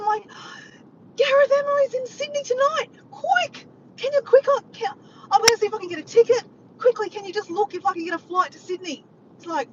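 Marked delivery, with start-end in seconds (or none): 1.2-9.0 high, loud, urgent demands; frantic yelling. Retold or imitated. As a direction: re-enact a breathless rush for help. Re-enacts frantic yelled request to Diego.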